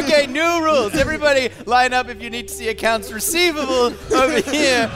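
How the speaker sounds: calling out. Acted. GM plays office clerk calling out.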